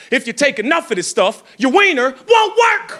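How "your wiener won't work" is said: high, drawn-out mock alarm; high-pitched punchline. Comic warns the fellas about the drug, high drawn-out punchline. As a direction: jump up high and stretch the punchline.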